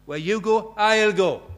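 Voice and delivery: loud, high pledge; devoted vow. Retold or imitated. Ruth pledging loyalty to Naomi, loudly declared. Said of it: "Ruth, uh, and Naomi".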